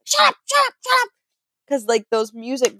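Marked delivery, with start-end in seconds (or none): 0.0-1.1 put-on very high, frantic kid's hissing yell; bossy sibling. Older sister hitting her brother to shut him up, very high frantic. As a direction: shush your little brother in a panic. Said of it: "being like".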